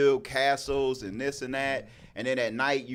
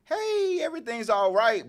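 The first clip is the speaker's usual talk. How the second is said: mock innocent kid; 10 semitones higher than usual. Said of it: "kids can act".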